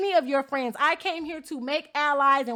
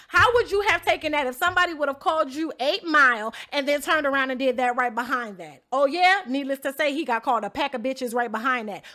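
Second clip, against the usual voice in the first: normal talking voice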